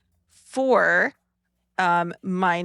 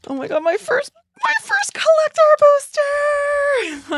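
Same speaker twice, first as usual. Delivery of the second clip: thrilled squeal; 7 dB louder than usual. Real, not acted. Opening her first collector booster, a delighted high squeal. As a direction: pitch jumps way up, stretched out and louder.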